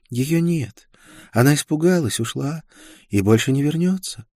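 high, airy zealot's voice; devout young woman. A narrator's reading. Isolde insists to Karl the witch has gone, high-voiced. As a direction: answer from high up, lost in belief. Isolde answers, sure of herself.

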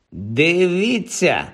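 протяжний вигук вищим тоном; гукни решту, розтягуючи слово; гукання. A narrator's reading. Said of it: "гукнув Мелфой". (протяжний поклик)

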